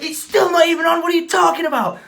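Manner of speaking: loud retold yell, pitched high; retelling the argument, shouting at the driver; mock angry shout. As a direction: yell it back at the driver, indignant. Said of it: "I was like".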